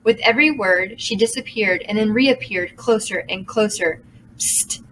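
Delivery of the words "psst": soft hissed psst. A narrator's reading. Minnie hisses to Aru, soft hissed psst. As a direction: hiss it to get attention. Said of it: "hissed Minnie".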